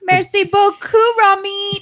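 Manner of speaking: put-on French accent. Cohost playful French.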